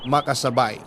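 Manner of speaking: ordinary talk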